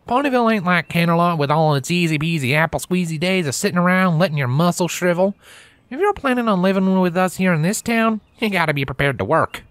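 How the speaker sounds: high, lilting country mare, firm; a country mare warning a city pony; Applejack's country voice. Acted.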